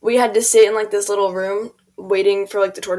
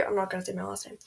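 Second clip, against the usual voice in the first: hushed aside to the camera; real whisper, quick aside; 14 dB quieter, faster than usual. Real, not acted. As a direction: whisper it so only viewers hear. Privacy aside to viewers.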